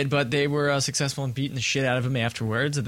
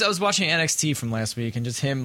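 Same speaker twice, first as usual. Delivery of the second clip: normal talking voice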